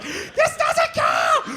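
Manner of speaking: high, slow shouted complaint; whiny protest. Retold or imitated. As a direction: drag it out in protest. Loser protesting.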